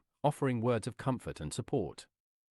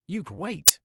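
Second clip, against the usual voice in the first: louder, higher exclamation; mild shout; 6 semitones higher, 7 dB louder than usual. A narrator's reading. Lucy and Kylie calling out to wait, mild shout. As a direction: raise the voice in a short cry. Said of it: "Lucy and Kylie shouted".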